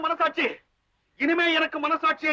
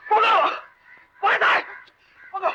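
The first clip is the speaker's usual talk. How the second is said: angry dismissal; 6 semitones higher than usual. Acted. Man angrily sends the helper away.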